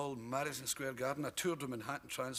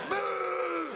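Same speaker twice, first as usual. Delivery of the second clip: high, loud mock boo; imitating a booing crowd; 5 dB louder than usual. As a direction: jeer like a hostile crowd.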